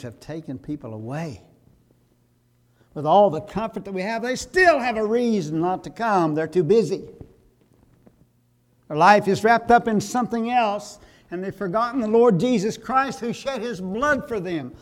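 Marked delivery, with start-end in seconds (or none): 2.9-14.7 much louder and higher, a rising fervent rebuke; preaching at full force. Acted. Pastor rebuking congregation over material things, full-force preaching. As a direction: hammer each emphasis line like a revival preacher.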